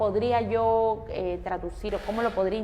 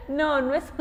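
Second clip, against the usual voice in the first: amused, can't keep a straight face; laughing; 6 semitones higher than usual. Real, not acted. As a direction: laugh while saying no. Laughing at pupil's mistaken answer.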